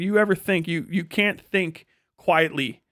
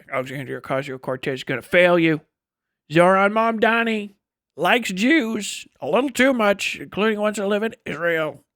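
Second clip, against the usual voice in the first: mocking online critic; 5 semitones higher, 4 dB louder than usual. Mocking online critics' talking points.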